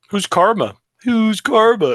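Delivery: high, building teasing question; mock prying interrogation. Acting out teasing the player about Karma, high mock prying. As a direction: press them playfully, pitch climbing.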